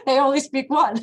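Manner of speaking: amused, words breaking into a laugh. Real, not acted. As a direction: laugh through the punchline. Laughing at her own punchline.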